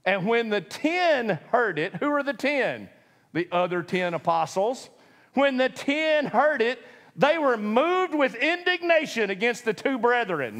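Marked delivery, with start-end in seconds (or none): 0.1-2.0 slow, deliberate Bible reading; scripture reading. Shown as a demonstration. Preacher reading the verse slowly to the congregation. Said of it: "What happened in verse twenty-four?". 5.3-10.6 slow, raised formal reading of the verse; scripture reading. Shown as a demonstration. Preacher rereading the verse word by word, formal and slow. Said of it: "that's how it's written".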